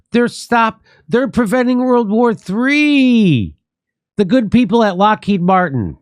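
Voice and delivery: high, drawn-out mock cheering; sarcastic mock praise. Host mocking the President's Lockheed speech, in sarcastic high-pitched praise. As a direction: gush in a high, put-on voice.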